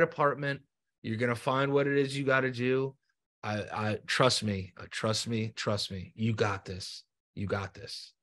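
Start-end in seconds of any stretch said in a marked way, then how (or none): 2.2-8.1 low, soft, sincere encouragement; tender reassurance. Real, not acted. Host telling guest to trust them, tender and hushed. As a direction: drop the voice and reassure gently.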